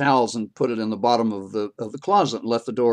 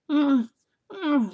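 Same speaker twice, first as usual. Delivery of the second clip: high wordless cat whine; short, high closed-mouth "mm" like a cat; imitated cat noise. Storyteller imitating the cat giving birth, high humming whines. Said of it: "like this".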